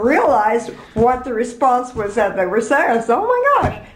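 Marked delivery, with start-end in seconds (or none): 3.2-3.9 high-pitched mock astonishment; amused surprise. Retelling the moment she understood the response, in amused surprise. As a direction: exclaim it high, as a sudden realization. Retold realization of childhood mistake.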